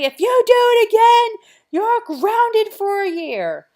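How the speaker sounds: high, loud, slow parent threat; hammed-up threat to ground them; mock angry parent. Parent threatening kids with grounding, high loud mock threat.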